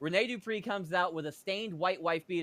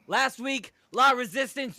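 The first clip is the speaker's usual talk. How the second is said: high, loud pumped-up promo delivery; wrestler promo voice; 7 semitones higher, 7 dB louder than usual. Shown as a demonstration. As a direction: restart it as a big wrestling promo. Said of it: "hold on".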